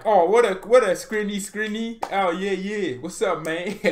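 high, loud, hammed-up banter; Muller. Muller teasing defender Skriniar in the tunnel, rowdy. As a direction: play an overexcited teammate hollering greetings.

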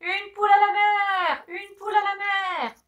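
high, loud sailor's cry; sailors' shout. Acted. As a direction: raise the alarm across the deck. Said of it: "par des cris".

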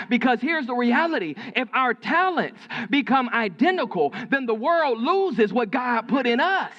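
high, forceful sermon delivery; emphatic preaching. Real, not acted. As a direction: drive the point home to the congregation.

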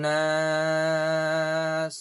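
measured Quranic recitation. Acted. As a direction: recite slowly with long drawn vowels. Recites the ayah's ending word.

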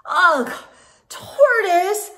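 huffed. Acted. Squirrel turning back for Tortoise, annoyed huff. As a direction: sigh it out, fed up. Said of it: "huffed Squirrel".

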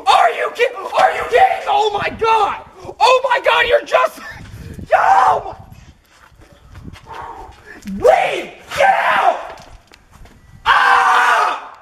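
high, loud raging yells; furious shout. Acted. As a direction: blow up at the news. Dad furious at pregnancy.

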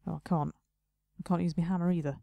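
ordinary delivery

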